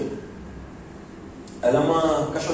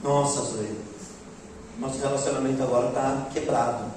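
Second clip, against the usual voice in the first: soft, lower, mock-wounded plea; mock hurt; 5 semitones lower, 5 dB quieter than usual. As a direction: pretend heartbreak to the dog, quietly. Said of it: "dissesse assim". (drama fingido e baixinho com a Zoe)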